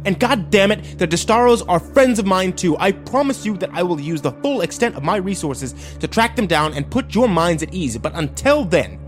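high, emphatic angry lecture; stern father losing patience. A narrator's reading. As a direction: lay down the law heatedly. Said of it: "The sheriff slammed his hands on the desk".

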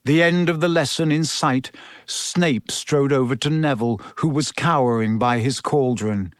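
usual speaking voice

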